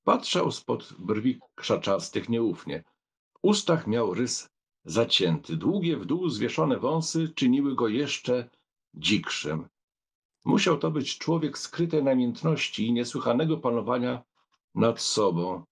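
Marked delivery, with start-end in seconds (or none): none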